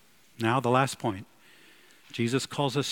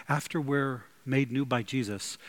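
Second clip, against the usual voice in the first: ordinary delivery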